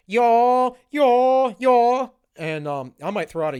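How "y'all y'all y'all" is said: loud, high, drawn-out put-on Southern twang; mock Southern accent. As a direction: lay on a pandering Southern drawl, pitched up. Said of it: "she went down to the South, she started going".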